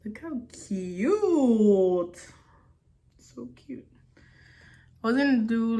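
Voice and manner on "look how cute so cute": long drawn-out, swooping coo on cute; gushing coo. Real, not acted. Vlogger admiring her own simple makeup look, drawn-out gushing coo. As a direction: gush over it, stretching the last word right out.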